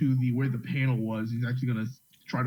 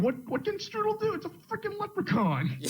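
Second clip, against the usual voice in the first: incredulous. Real, not acted. Player reacts to leprechaun.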